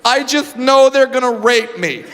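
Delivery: slightly high, earnest confession voice; earnest phobic woman. Retold or imitated. A lightly voiced woman explaining her fear to the comedian. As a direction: say it like you truly believe it. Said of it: "She goes".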